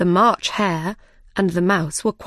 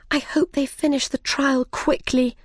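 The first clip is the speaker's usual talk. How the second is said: lightly voiced Alice, higher; Alice's thoughts; 6 semitones higher than usual. A narrator's reading. Alice eyeing the tarts and wishing to herself, higher voice. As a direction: think it aloud in a higher girl's voice.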